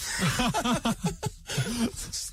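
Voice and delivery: breaking into high laughter. Real, not acted. Comedian laughing at his friend accepting breakfast, high laugh. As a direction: laugh at the sudden switch.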